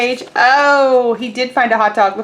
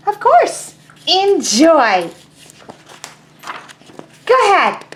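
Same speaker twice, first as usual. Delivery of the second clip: high, small duckling voice; a tiny curious duckling; 6 semitones higher, 4 dB louder than usual. Acted. Teacher voicing the duckling asking Pigeon, high and small.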